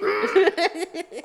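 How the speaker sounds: high giggling laugh; high giggle. Real, not acted. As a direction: break into a giggle.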